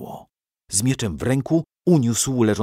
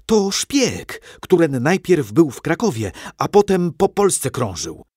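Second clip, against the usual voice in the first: high, raised revelation; excited exclamation; 7 semitones higher, 4 dB louder than usual. A narrator's reading. Knight revealing the dead man as spy, excited and high. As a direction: blurt out who he was. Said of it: "wykrzyknął Marcin".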